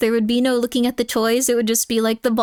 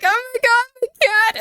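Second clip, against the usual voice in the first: mock panicked shriek; 12 semitones higher, slower than usual. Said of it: "going like".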